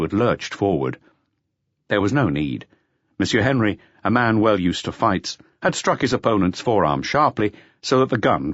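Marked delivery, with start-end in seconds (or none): none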